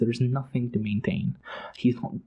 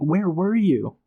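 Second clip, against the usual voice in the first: furious outburst; 7 semitones higher than usual. Retold or imitated. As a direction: hurl the blame back at them. Voicing the king's furious outburst.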